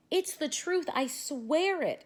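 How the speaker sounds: high, slow, pleading swear; earnest exclamation. A narrator's reading. Boy swearing to his teacher, high and pleading. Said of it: "exclaimed Stevie".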